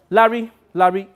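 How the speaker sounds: loud urgent call; calling the director loudly. Real, not acted. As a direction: call his name to stop him.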